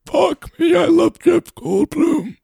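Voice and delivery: high, slow, strained silly voice. Acted. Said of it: "That hurt my throat".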